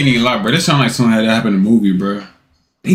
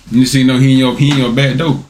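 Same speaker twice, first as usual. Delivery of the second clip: usual speaking voice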